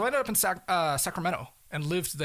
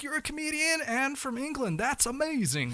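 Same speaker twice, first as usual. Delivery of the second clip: excited; 11 semitones higher than usual. Retold or imitated. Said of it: "he was like".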